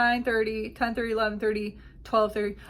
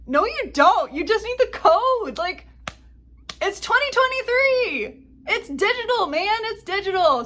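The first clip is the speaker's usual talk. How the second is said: high, loud, incredulous protest; exasperated retort; 10 semitones higher, 5 dB louder than usual. Retold or imitated. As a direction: push it up high in mock exasperation. Acted exasperated retort to bag handler.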